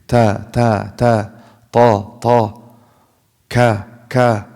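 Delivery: ta and ka with barely any puff; minimal breath. Shown as a demonstration. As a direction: keep aspiration on T and K almost inaudible. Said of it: "there's some breath, like one percent".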